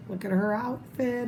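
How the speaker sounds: ordinary delivery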